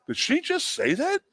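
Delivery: stunned, stretched out; shocked. Retold or imitated. Son hearing mom question coach, high stunned voice. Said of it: "jaws were like".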